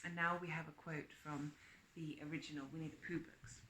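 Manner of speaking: ordinary talk, nothing marked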